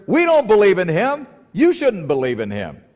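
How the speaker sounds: high, loud scornful scolding; mock indignant Pharisee. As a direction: sneer down at the gullible crowd. Preacher voicing the Pharisees' contempt.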